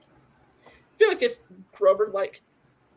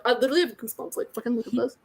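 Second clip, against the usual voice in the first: ordinary delivery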